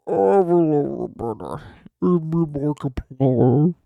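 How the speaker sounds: slow, loud hammed-up impression; Markiplier. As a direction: drag the words out loudly, garbled. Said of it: "it's me, Markiplier".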